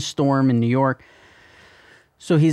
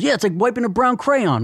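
dad's quip; 9 semitones higher than usual. Retold or imitated. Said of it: "My dad goes".

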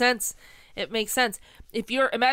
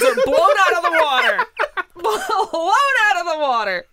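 laughing while talking; cracking up at the twist; 5 semitones higher, 5 dB louder than usual. Real, not acted.

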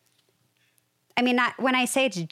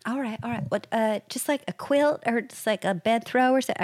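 higher-pitched, lightly put-on Southern hostess; terrible Southern accent; 4 semitones higher than usual. Mother-in-law asking the couple for a quilt, light Southern accent. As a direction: imitate a Southern hostess making do. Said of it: "this is a terrible accent I'm doing".

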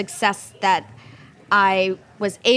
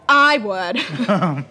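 laughing; 3 semitones lower than usual. Retold or imitated. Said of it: "I was like".

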